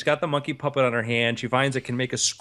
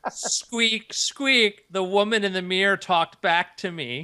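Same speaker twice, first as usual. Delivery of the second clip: a hint of a higher squeaky voice; creepy puppet voice; 6 semitones higher, slower than usual. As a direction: spook them with a lightly squeaked horror line. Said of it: "that's a horror movie".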